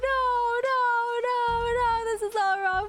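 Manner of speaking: wailing, close to tears; anguished wail. Retold or imitated. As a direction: cry out in despair. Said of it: "So I was just like".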